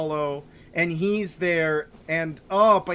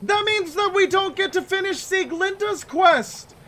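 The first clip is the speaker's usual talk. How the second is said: upset ranting; 10 semitones higher than usual. Real, not acted. Player venting that the broken weapon was for nothing, high and outraged. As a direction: vent at the screen in disbelief. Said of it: "Oh, I'm so mad".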